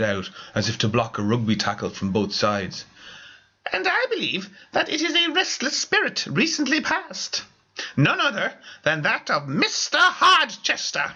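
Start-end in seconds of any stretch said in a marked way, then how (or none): 3.7-11.1 very high, mystic proclamation; spiritual medium. Acted. Medium naming the spirit to the meeting, mystic proclamation. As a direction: channel the departed with grand solemnity.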